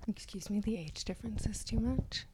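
a hushed, scandalised whisper; mock whisper. Cohost whispers his words back in mock shock.

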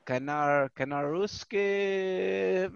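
very slow, halting reading of a street name; hesitant, drawn-out imitation of dad; imitated dad. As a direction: sound out an unfamiliar word as a puzzled dad, dragging it. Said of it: "He goes, uh".

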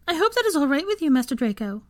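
very high, anxious house-elf voice; nervous elf. A narrator's reading. Elf asking master's leave for a date, nervous. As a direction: squeak it nervously, seeking approval. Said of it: "Tansy said nervously".

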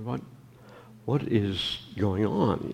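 normal talking voice

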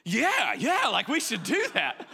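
high, loud burst of sudden enthusiasm with a laugh; caught-up yell. Retold or imitated. Listener catching someone's passion, suddenly yelling agreement. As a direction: get swept up mid-sentence. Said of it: "if they get fired up enough".

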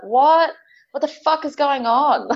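high, loud bewildered what; shocked disbelief. Retold or imitated. Reliving the shock of being touched, high and loud. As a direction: blurt it out in disbelief. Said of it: "I was like".

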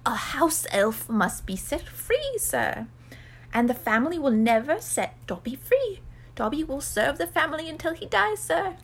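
servile little creature accepting its fate; resigned house elf. Acted. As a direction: explain bondage in an elf voice. Plays Dobby explaining his bondage.

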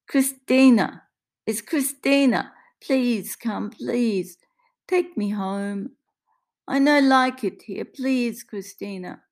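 high, pleading Italian woman; desperate, tearful imploring in accented English; fighting back tears. Acted. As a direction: beg down the phone to be taken home. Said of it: "Maria is fighting back tears now".

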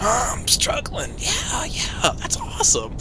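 slow, whispery struggling impression; mock uncomfortable American accent, very soft; Tom Hardy. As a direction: strain out the words under your breath. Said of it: "He's over here like".

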